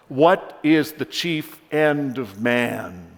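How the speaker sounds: slow, emphatic officer's challenge; loud, measured quizzing; probing officer's question. Acted. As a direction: pose it deliberately and firmly, like a test. Officer solemnly quizzes young man.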